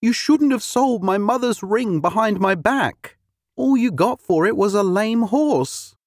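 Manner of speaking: high, reproachful woman's voice; a hint of an upset wife. A narrator's reading. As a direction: scold him over the ring. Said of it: "said a distressed woman".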